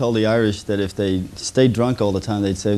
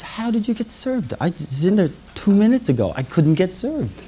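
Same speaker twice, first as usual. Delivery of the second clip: put-on high drunk whine; 9 semitones higher than usual. Drunker friend complains.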